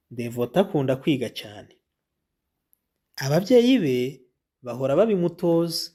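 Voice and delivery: clear, even storybook reading; reading to children. Acted. Teacher reading the Devota story to pupils, clear and even.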